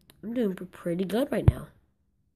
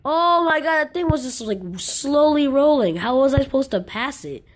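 high, loud protest; exasperated outburst; 6 semitones higher, 7 dB louder than usual. Real, not acted. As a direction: wail about the unfair obstacle. Blocked by a slow obstacle.

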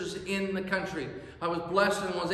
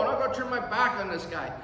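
normal talking voice

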